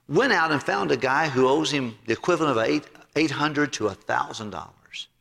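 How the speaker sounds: usual speaking voice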